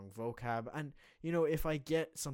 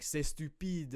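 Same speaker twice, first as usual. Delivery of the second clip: put-on higher, playful over-the-top French accent; exaggerated French accent; 6 semitones higher, 4 dB louder, slower than usual. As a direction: mess about in a hammed-up French accent. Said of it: "It's a very good accent".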